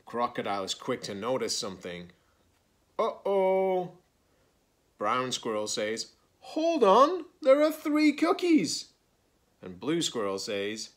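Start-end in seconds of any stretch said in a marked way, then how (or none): 3.0-3.9 high, loud storybook alarm; worried uh-oh. A narrator's reading. Crocodile notices something wrong, alarmed. 6.4-8.9 very high, loud squirrel voice; alarmed. A narrator's reading. Brown Squirrel counts too few cookies, alarmed.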